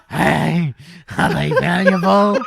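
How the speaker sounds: loud, high mimicry; imitated character voice. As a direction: mimic the silly voice through laughter. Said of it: "That's his voice, all right?".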